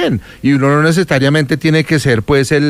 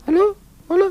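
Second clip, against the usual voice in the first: squeaky, much higher put-on voice; mock caller voice; 3 dB quieter than usual. Pretending to be the silent caller Dana, squeaky falsetto.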